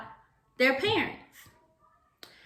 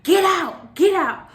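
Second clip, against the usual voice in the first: higher, louder snapping order; frustrated teacher; 8 semitones higher, 6 dB louder, slower than usual. Retold or imitated. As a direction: play the fed-up teacher sending a kid out. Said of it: "she was like".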